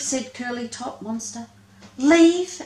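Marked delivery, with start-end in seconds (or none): 2.0-2.6 shocked, much higher and louder; exclaimed. Acted. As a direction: leave blurted in disbelief. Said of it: "exclaimed Lesser Spotted Monster".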